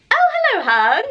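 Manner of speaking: very high, loud hello dropping on hun; sudden cooing greeting. Real, not acted. Breaking off her story to greet someone, high cooing.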